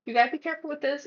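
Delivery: ordinary talk, nothing marked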